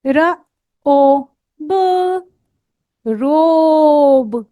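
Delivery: र, ओ, ब then a long slow रोब; sounding out robe. Shown as a demonstration. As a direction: stretch रोब out. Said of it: "sound change.". (अक्षर जोड़कर लंबा रोब)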